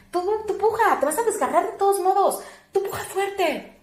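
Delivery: high-pitched imitation of a pushy command; insistent ordering. Attendant tells labouring mother to push, high insistent command.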